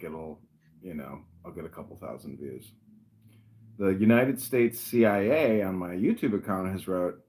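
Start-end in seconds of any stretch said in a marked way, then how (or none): none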